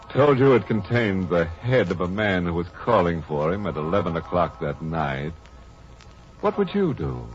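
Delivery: usual speaking voice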